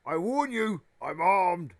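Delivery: high, loud threatening shout; shouted warning. Acted. Armed Uncle Vernon warning whoever is outside, shouting. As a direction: warn the intruder off at full voice. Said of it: "he shouted".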